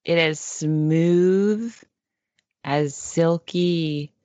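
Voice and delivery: slow, deliberate meditation-leader voice; solemnly soothe, pausing between phrases; mock guided meditation. Host reassuring crab-wary co-host, slow mock meditation.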